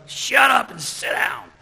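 slow, high, loud mock shout; shouted scolding. Teacher to the little kindergarten, shouted order. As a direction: holler it like a fed-up teacher.

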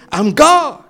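high, loud declaration; proclaiming. Acted. Jesus declaring to Mary, loud and high. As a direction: ring it out with full force.

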